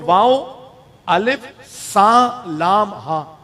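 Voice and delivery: very slow, letter by letter واو الف سا لام ہا; spelling out letters. Shown as a demonstration. Preacher spelling a companion's name for listeners, very slow. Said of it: "اس کی pronunciation بھی بتاؤں".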